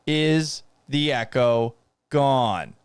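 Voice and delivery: slow, drawn-out question; weary, dragged-out asking; slow repeat. Real, not acted. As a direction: stretch each word out in exasperation. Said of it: "repeats it slowly".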